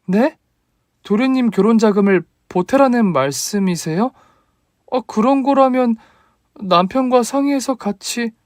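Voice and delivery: put-on a startled young bride answering her mother-in-law; daughter-in-law voice.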